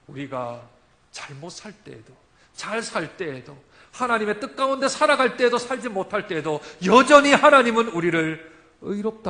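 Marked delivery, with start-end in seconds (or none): 3.9-9.3 loud, rising sermon cry; full-force preaching. Acted. Preacher proclaiming to the congregation, loud. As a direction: preach it out to the whole church.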